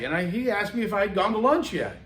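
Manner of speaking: normal talking voice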